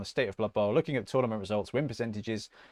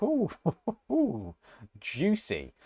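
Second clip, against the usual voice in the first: titillated; 7 semitones higher, much slower than usual. Retold or imitated. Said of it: "you're like".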